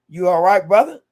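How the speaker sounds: climbing hype yell; excited shout-out. Real, not acted. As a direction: call out to your brother, delighted. Host hails Alonzo again.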